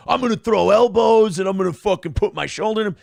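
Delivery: loud hammed-up tough guy; aggressive trash talk. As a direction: bark it like a bully on court. Acting an aggressive basketball player.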